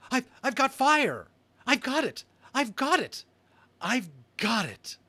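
high, loud, breathless joy; excited. Acted. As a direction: cheer it like a win. Boy exults over first fire.

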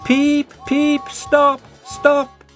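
high, loud panicked peeps; frantic whistle. A narrator's reading. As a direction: beg the train to stop. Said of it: "he whistled".

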